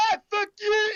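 high comic shouting; mock road rage yell. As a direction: yell across the traffic light for fun. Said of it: "he was like".

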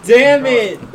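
loud, sharp curse; frustrated outburst. Real, not acted. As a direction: blurt it out in frustration. Chip falls, sudden outburst.

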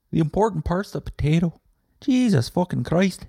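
high, excited Irish fan, drifting Scottish; over-the-moon Irish fan, ending a bit Scottish. Retold or imitated. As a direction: gush like an Irish listener, accent slipping to Scottish. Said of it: "that was a little Scottish at the end".

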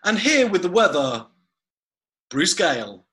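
bright TV announcer lead-in; weather presenter intro. Shown as a demonstration. Host trying out names, TV weather intro voice. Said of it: "would your preferred name be".

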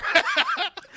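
high burst of laughter; loud high laugh. Real, not acted. Host cracking up at the dream text.

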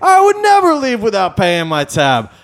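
high, loud, affronted protest; hammed-up indignant customer; mock indignation. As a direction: protest as if deeply insulted. Customer acted as indignant hypocrite.

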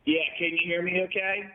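echoey phone call from a tiled room; echoing bathroom. Real, not acted. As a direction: sound like you're calling from a bathroom. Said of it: "you sound like you're in the bathroom".

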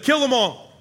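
high, loud command, repeated; barked order. Retold or imitated. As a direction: shout the order to your men as a raging king. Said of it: "he orders his men".

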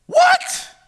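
very high, loud squawk; incredulous outburst. Acted. Preacher reacting to Saul's doubt, incredulous high squawk. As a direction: squawk it in disbelief. Mock disbelief at Saul's words.

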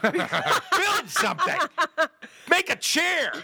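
very high, drawn-out demand; pleading high-pitched orders, half laughing; going nutty. Retold or imitated. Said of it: "You get a little nutty".

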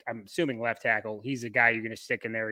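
ordinary talk, nothing marked

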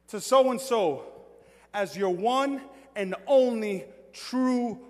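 slow, loud wedding officiant delivery; measured vow, each phrase set apart; officiant's vow. Acted. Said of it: "one line that I give to everybody".

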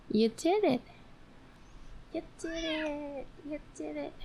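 high voice fading to very soft. Real, not acted. Owner praises the dog for the paw, high tiny cooing. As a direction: coo it high, shrinking to a tiny squeak.

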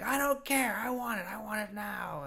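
high bratty whine; spoiled kid yelling. Retold or imitated. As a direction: demand it like a spoiled son. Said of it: "yelling at him".

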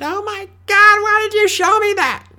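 high, loud shocked shriek; shocked receptionist. Retold or imitated. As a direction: shriek in disgust at the wound.

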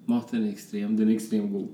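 usual speaking voice

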